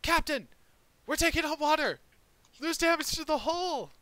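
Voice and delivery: high, loud alarmed shout; panicked sailors yelling from below decks; shouting crew. Acted. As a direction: call out an emergency to the captain. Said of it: "they shout exactly that".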